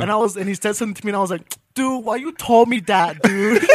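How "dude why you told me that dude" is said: slow, drawn-out mock Mexican-American accent; mocking paisa speech. Roasting friends for their grammar, slow mock Mexican accent. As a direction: stretch it out in a teasing Mexican accent. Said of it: "using the wrong grammar".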